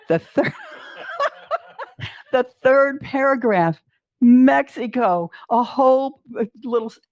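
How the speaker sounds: words through a laugh. Real, not acted. Finding Mexico in the paper, laughing. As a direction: laugh while saying it.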